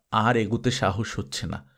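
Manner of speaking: usual speaking voice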